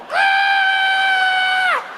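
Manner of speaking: piercing high scream. Retold or imitated. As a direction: shriek up high in panic. Crowd screaming.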